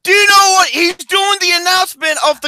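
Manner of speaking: loud, high-pitched scolding; exasperated shouting. Real, not acted. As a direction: yell at a friend who missed the point. Squabble over the impression.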